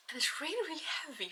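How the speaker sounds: whispered inside the helmet. Real, not acted. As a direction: breathe it out in a whisper. Straining under heavy helmet.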